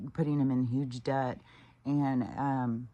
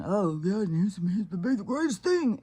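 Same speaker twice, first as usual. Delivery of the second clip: mocking quote; 6 semitones higher, 4 dB louder than usual. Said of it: "these fucking people with the".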